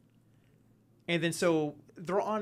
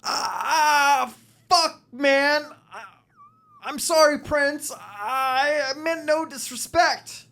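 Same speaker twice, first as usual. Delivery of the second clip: higher, louder whimpering apology in agony; pained pleading; 10 semitones higher, 9 dB louder, slower than usual. Acted. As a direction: beg for forgiveness through the pain. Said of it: "Marshall says".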